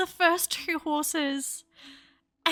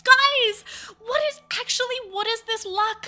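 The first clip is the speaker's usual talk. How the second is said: high, stretched-out disbelief; amazed, raised-pitch outburst; incredulous; 6 semitones higher than usual. Real, not acted. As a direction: exclaim it as if the luck is impossible. Disbelief at four fantasies in a row.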